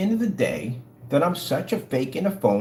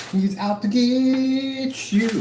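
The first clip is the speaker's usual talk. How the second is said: slow, high, eerie warning; hushed horror-movie tease; spooky voice; 6 semitones higher, much slower than usual. Acted. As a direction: draw it out like a campfire ghost story. Spooky warning about Crow.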